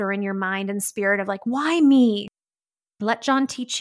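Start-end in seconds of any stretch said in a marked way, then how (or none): 1.5-2.3 exasperated cry. Retold or imitated. Voicing the victim mindset aloud.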